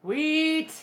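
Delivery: high excited sweet; delighted sweet. Real, not acted. Vlogger reacting delighted to the answer, high pitched. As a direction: pop the word up happily.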